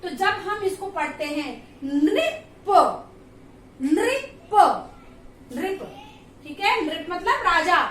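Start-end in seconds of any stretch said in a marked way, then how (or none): 1.8-5.8 "नृप" said slowly, with the half न leaning into री; pronouncing nripa. Shown as a demonstration. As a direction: sound out "नृप" with stress on न. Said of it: "न का आप जब प्रोनाउंस करेंगे". ("नृप" को धीरे-धीरे, न पर जोर देकर)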